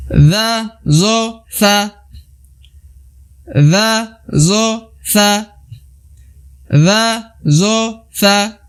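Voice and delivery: za, zo, sa chanted high; recitation drill. Shown as a demonstration. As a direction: lead the class through the letters. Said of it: "Kemudian kita lihat lagi".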